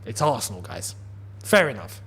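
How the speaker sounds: low, quiet, shrugging acceptance; resigned fair enough. Retold or imitated. Fan shrugging off a proper Arsenal goal, low and resigned. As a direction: concede it with a sigh. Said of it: "I'd be like".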